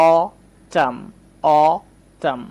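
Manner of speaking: "অটাম" split as "aw... tam", twice; teacher breaking "অটাম" into two slow parts; syllable-by-syllable pronunciation. Shown as a demonstration. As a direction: hold the "অ" of "অটাম", pause, then "টাম". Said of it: "অ এর মতো উচ্চারণ করছি".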